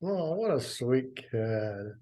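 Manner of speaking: usual speaking voice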